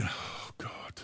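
breathless unvoiced whisper; whispered dread. Preacher whispering his dread before going on air. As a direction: whisper it like you're dreading what's next.

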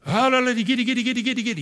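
high, rapid babble of praise; imitated praying in tongues. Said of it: "we just praying in tongues".

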